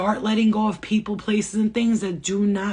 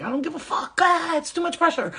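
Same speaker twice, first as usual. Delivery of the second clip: higher, put-on frazzled voice; higher mock whine; mock overwhelmed self; 9 semitones higher than usual. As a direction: whine it like it's all too much.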